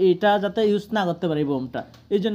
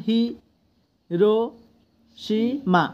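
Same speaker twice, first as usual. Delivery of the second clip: "হি, রো, সি, মা" as separate beats with long pauses; syllable by syllable; 2 semitones higher, slower than usual. Shown as a demonstration. Teacher shows each syllable of Hiroshima stands alone, syllable by syllable. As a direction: pause clearly after each part of "হি, রো, সি, মা". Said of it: "এটা আলাদা আলাদা syllable".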